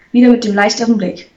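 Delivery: plain reading voice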